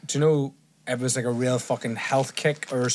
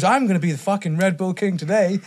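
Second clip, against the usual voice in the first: higher, cocky announcement in a put-on accent; Tristan Tate; 6 semitones higher, 4 dB louder than usual. Guest retelling the Red Bull challenge, Tristan Tate impression. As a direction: brag it out in his accent. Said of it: "You sound like him. That's a good accent".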